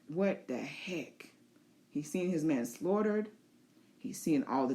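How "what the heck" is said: slow, low, dazed mutter; low slow stunned disbelief; dazed Corlys. Pretend. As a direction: wake up stunned amid the carnage. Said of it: "Corlys is like".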